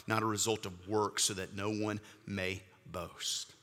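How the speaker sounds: steady, measured scripture reading; reading scripture to the congregation. Acted. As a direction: read the verses with reverent weight. Reads scripture passage aloud.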